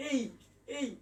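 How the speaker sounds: "এই" with only a light, short i; barely touch the i ending of "এই"; light half glide. Shown as a demonstration. Teacher showing the half-light ending, light glide. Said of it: "অর্ধেক হালকা উচ্চারণ করবেন".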